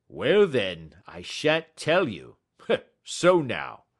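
loud, stubborn refusal; defiant salesman. A narrator's reading. Salesman refusing Holmes, loud and defiant. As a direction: dig in and refuse him, loudly. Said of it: "a burst of anger from the salesman".

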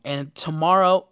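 ordinary talk

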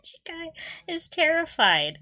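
ordinary talk, nothing marked

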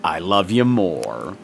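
slow, low, lightly creepy; a hint of a creepy lover; creepy cute reply. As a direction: coo it back, but it comes out creepy. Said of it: "trying to sound cute just sounds creepy".

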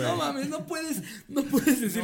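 high, slow laughing protest; high incredulous laugh; laughing disbelief. Real, not acted. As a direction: crack up in disbelief.

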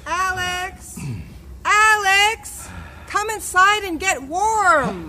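calling out. Acted. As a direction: call him in from the doorway. Mother calling son indoors.

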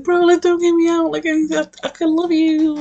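put-on high, pleading puppy-boy voice; pleading dog man. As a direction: beg like a sweet dog who wants to stay.

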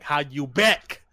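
loud, slow, forceful delivery; mock aggressive. Shown as a demonstration. Friend correcting the learner with a forceful demonstration, mock aggressive. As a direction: punch each word out slowly. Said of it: "say it like you're pissed".

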